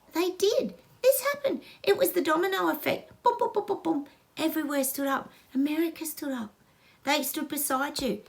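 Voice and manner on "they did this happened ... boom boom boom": high and rising with each boom; excited. Real, not acted. Praising the whole world for standing up, excited. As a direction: build it up like dominoes falling. Excited about the domino effect.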